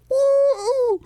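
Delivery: loud, high party whoop; Gronk. Retold or imitated. Hammered athlete celebrating after spiking the bust, imitated whoop. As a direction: let out a long, rowdy mock holler. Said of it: "And Gronk's like".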